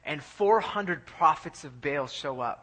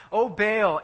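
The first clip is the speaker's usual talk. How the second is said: louder, higher invocation; raised-voice plea, drawn from the chest; calling out; 5 semitones higher, 8 dB louder than usual. Retold or imitated. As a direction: imitate worshippers calling up to their god. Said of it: "they begin to call out".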